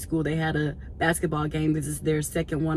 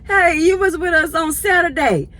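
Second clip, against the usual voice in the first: put-on high, loud old lady greeting; imitating the elderly lady; 10 semitones higher, 6 dB louder than usual. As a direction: call out like a sweet elderly churchgoer. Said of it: "She was like".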